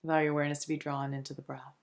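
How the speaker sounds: soft, soothing meditation voice with long pauses between phrases; unhurried, hushed relaxation guide; guided meditation. Acted. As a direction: gently guide them into calm, phrase by phrase.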